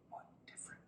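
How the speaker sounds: whispered aside. Real, not acted. Teacher whispers the key contrast to students. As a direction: breathe it as a secret. Hushed aside after definition.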